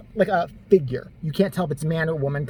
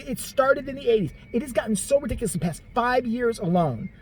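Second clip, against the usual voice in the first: usual speaking voice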